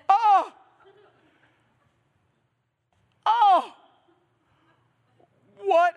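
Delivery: loud, high yelp of shock; hammed-up shout of sudden alarm; startled cry. Acts Paul bitten by viper.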